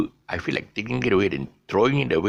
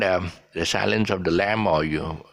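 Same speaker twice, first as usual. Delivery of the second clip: ordinary talk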